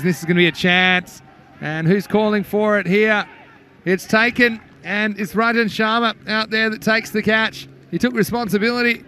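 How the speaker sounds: rising live play-by-play excitement, then settling as the batsman walks; excited catch call. Real, not acted. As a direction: lift the voice as the chance goes up and is held.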